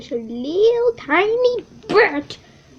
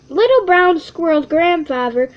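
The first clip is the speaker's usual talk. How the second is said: ordinary delivery, nothing marked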